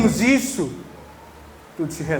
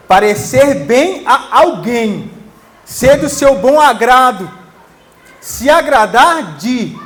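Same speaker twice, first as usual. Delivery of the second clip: slow, loud, every phrase hammered; drawn-out pulpit emphasis; slow emphatic preaching; 3 semitones higher, 8 dB louder, slower than usual. Acted. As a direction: preach each meaning out slowly and forcefully. Preacher spelling out word meaning.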